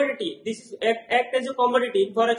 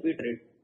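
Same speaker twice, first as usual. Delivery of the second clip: quiet, much lower बिड रेट; term repeated for class; 10 semitones lower, 7 dB quieter than usual. Shown as a demonstration. Teacher drilling students on the term, low answer. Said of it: "क्या बोलते हैं?".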